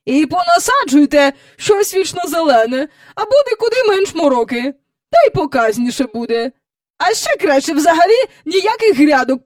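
high, loud imperious advice; bossy visitor. A narrator's reading. Visitor ordering the hostess's flowers removed, bossy. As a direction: order it done.

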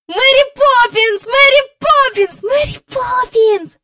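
very high, loud, repeated calls; joyful shouting. A narrator's reading. Both children clinging to their returning nanny, shouting. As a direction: scream the name in delight. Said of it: "кричали обоє".

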